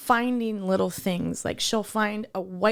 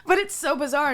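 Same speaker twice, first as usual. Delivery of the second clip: laughing through tears; 6 semitones higher, 4 dB louder than usual. Real, not acted. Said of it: "You're crying".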